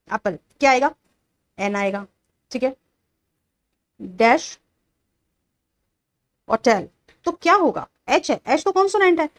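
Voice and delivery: ordinary talk, nothing marked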